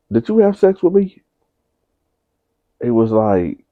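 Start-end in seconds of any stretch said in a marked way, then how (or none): 0.1-1.2 sharp high-pitched question; mother asks her son in shock, voice jumping high. Retold or imitated. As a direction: ask it in high disbelief. Mother confronts son in story.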